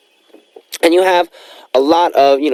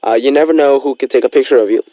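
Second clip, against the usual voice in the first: ordinary talk, nothing marked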